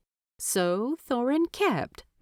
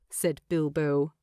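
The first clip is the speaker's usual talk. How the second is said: ordinary delivery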